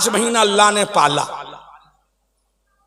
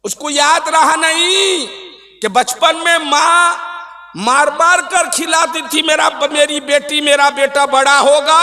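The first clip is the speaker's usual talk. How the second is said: raised, chant-like sermon delivery; preaching; 6 semitones higher than usual. Acted. Preacher reproaching ungrateful children about mother's care, impassioned preaching. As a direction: declaim it with long ringing line endings.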